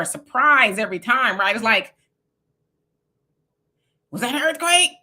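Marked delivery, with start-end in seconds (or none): 4.1-4.9 high, startled wondering; mock alarmed question. Californian re-enacting a quake starting, startled question. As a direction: act out a sudden double-take, pitched up. Acting out noticing an earthquake.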